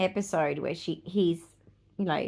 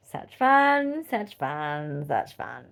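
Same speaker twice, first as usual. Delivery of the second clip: sarcastic; 5 semitones lower, much slower than usual. Acted. Sarcastic about decades of periods.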